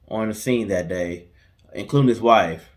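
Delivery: ordinary talk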